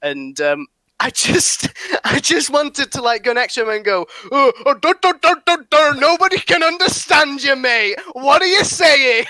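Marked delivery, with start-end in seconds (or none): 4.3-9.2 higher and louder, hammed-up mockery of the man; mock rude outburst. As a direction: jeer at him loudly like a rude bloke. Mocking an imagined rude remark.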